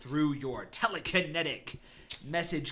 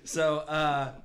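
ordinary talk

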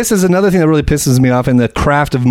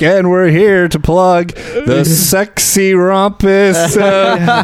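slow, drawn-out mock announcer plug, pitched up; sports commentator; 4 semitones higher, much slower than usual. Mocking commentators plugging sexy volleyball, announcer voice. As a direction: imitate a commentator hyping the event word by word. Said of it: "commentators are all like".

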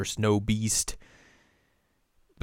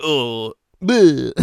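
loud high disgusted bleh; mock gag; 4 semitones higher, 10 dB louder than usual. Sango tasting the restorative, loud mock gag. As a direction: spit out a gagging bleh. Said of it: "She's like".

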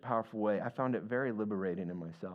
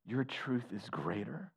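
quiet weeping prayer; 3 dB quieter, slower than usual. Retold or imitated. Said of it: "he began to weep and go".

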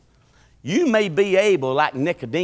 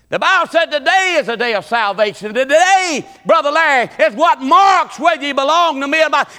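shouted preaching; 10 semitones higher, 7 dB louder than usual. Acted. Preacher shouting at his congregation to choose today. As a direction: call the whole church to choose today.

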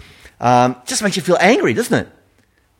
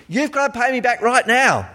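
high demanding creditor voice; angry creditor; 9 semitones higher than usual. Retold or imitated. Creditor throttling debtor, high demanding voice. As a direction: press him for the money. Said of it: "you're like".

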